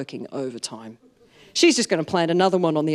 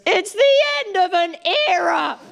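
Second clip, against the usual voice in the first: high-pitched, hammed-up crying voice; mock tearful wail; 12 semitones higher, 3 dB louder than usual. Mum crying over moving house, melodramatic mock wail. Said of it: "Why are you crying?".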